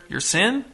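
"sin" lifted high in a soft question; questioning rise. A narrator's reading. Young mother prompting Pierce, "sin" rising questioningly.

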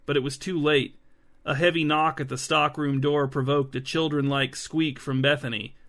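usual speaking voice

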